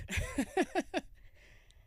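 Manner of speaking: loud high giggle burst; delirious laugh. Real, not acted. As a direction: laugh tiredly, a bit loopy. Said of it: "the giggles are coming from".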